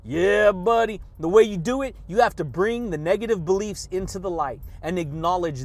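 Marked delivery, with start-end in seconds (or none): 0.1-1.0 loud, hyped cheer; loud catchphrase. Real, not acted. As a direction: shout it like a hype man. Shouted catchphrase.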